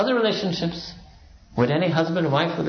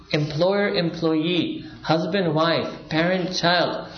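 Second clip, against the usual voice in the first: each pair laid out slowly; slow listing; much slower than usual. Real, not acted. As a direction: count them off one by one, slowly.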